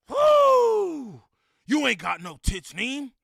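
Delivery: loud self-hype whoop; gym hype talk. Shown as a demonstration. Pumping himself up in the gym mirror, loud hype. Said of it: "That's how I talk to myself in the gym".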